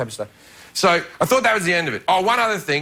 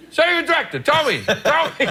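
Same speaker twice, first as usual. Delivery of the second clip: hammed-up Pacino urging; 10 semitones higher than usual. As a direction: egg him on like a theatrical Pacino. Pacino pushing him to declare.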